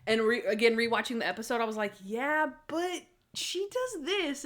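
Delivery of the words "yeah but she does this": high, slow, drawn-out objection; mock protest. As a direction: stretch out the objection in a raised voice. Acts out own objection while rewatching.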